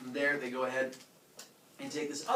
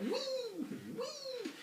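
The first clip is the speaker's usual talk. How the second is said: tiny high squeal of delight; very high, light "whee" of children; gleeful kids riding; 7 dB quieter than usual. Retold or imitated. Kids riding on the bull's horns.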